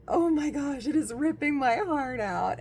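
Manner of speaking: fake-sob over the heartbreak; playful half-crying lament; mock cry. Reacting to lovers separated.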